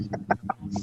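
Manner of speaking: whispered tak tak tak clicks; soft voiceless clicking imitation of a creeping ghost; imitated tapping sound. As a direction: tap it out softly like something creeping up the stairs. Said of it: "may tunog na tak".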